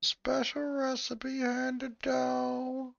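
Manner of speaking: put-on high, very slow old man voice. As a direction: draw it out like a creaky old man. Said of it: "Putting my old man voice on now".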